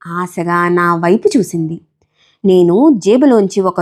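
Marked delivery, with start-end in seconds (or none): none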